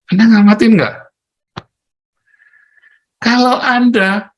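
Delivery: high, loud call to the hall; preaching shout. Real, not acted. Preacher to the congregation, a high loud call. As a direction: demand an answer from the crowd.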